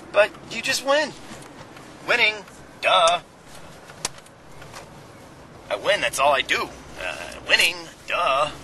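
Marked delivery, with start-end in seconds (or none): none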